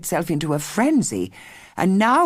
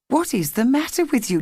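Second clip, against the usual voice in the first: higher, concerned mother asking; mother asking her daughter gently, higher; mother's voice; 8 semitones higher than usual. A narrator's reading. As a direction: lift it into a worried mother. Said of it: "said her mother".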